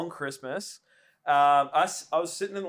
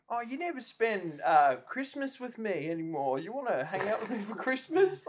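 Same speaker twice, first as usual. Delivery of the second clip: imitated mum; 6 semitones higher than usual. Mum inviting her kid over for Christmas, lightly imitated. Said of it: "Mum's just like".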